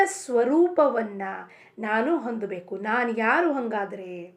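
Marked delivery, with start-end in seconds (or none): none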